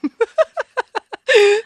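high, loud burst of laughter. Real, not acted. Laughs at own impression.

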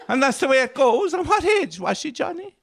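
high, prying village gossip; nosy mourner. Acted. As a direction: ask it like a curious neighbour. Mourner questioning widower at wake.

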